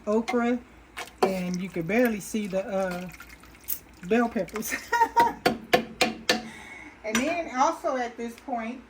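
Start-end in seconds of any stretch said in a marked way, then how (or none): 4.8-7.0 long, high, breathy laugh. Real, not acted. Host tickled by the barely visible bell peppers, long high laugh. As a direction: let a high giggle run on.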